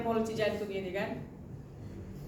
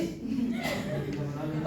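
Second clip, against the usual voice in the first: airy high laugh; breathy laugh; 5 dB quieter than usual. Real, not acted. Laughing at her own story. (tawa terengah)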